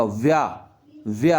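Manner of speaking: व्या starting with v, as vyaa; व्या with a half व; correct v start. Shown as a demonstration. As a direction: begin व्या on a v, lips not closed. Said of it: "व आएगा".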